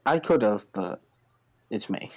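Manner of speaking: ordinary talk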